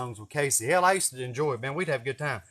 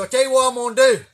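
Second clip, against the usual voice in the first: loud, high menacing officer; loud cocky cop building up a threat; prick cop voice; 8 semitones higher, 11 dB louder than usual. Retold or imitated. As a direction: threaten him like a power-tripping cop. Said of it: "talking like a fucking prick motherfucker".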